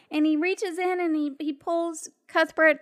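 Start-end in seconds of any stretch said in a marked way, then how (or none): none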